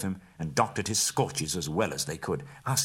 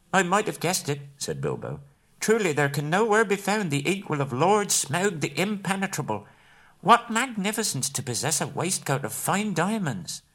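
much higher, nervous flattering hobbit voice; butter up the dragon, voice pitched high; Bilbo flattering; 11 semitones higher, 3 dB louder than usual. A narrator's reading.

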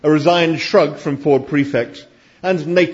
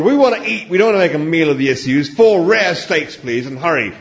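loud, raised, brisk demand; impatient order; 5 semitones higher, 5 dB louder than usual. A narrator's reading. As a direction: cut through the fuss and demand food. Zaphod impatiently orders for the table.

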